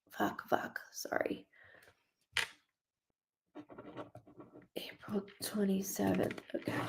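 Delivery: real whispered curse to herself. Real, not acted. Medium to herself, writing a date: whispered. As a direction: whispers under her breath, checking a date. Muttering to herself mid-reading.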